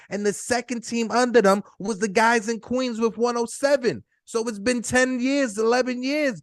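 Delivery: high, pumped-up rant; excited. Real, not acted. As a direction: build the hype, push it. Hyped rant about payroll.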